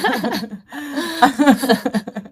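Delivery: high burst of laughter; real amused laughter; laughing. Real, not acted. Amused by host's joking comparison.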